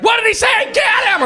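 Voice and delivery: high, loud mock-angry yell; outraged crowd. Comic imitating a reactionary San Francisco crowd, outraged shout. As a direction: shriek it like a mob turning on someone. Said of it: "They're so reactionary".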